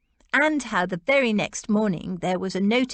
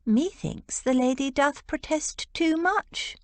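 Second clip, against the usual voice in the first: higher, knowing and teasing; archly; 7 semitones higher than usual. A narrator's reading. Rose teasing her sister about the Duke, arch and knowing. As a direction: tease her with a sly quote. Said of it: "Rose said archly".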